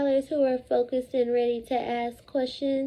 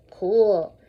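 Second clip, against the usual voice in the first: low, falling "Cool"; 6 semitones lower, 4 dB louder than usual. Shown as a demonstration. Teacher modelling the word again, low and calm. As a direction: drop "Cool" down low and easy. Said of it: "First I say, then you say".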